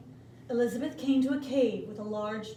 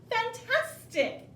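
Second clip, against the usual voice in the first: high, slow, drawn-out Fantastic; mock amazement; 12 semitones higher, 4 dB louder, much slower than usual. A narrator's reading. Princess praising the dragon in mock amazement, high and slow. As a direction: gush with put-on wonder. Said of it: "said Elizabeth".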